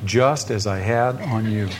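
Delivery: "just as I had on you" slow and spaced out; measured, deliberate "just as I had on you"; pointed emphasis. Shown as a demonstration. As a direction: underline each word of "just as I had on you". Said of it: "underline those words".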